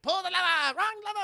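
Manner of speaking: high, loud comic shout; mock cartoon yell. Villain at the secret passage lever, high mock shout.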